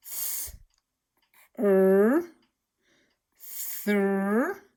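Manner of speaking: "Th-, er, thir" stretched out sound by sound. Shown as a demonstration. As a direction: build "thir" from its pieces. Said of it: "You have the T H digraph".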